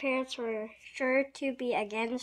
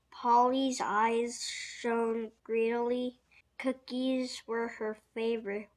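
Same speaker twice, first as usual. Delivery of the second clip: plain reading voice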